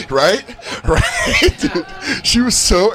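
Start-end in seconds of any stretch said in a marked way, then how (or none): 0.1-1.6 high laughing right; dog bragging. Retold or imitated. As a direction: brag through laughter as the dog. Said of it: "she's like".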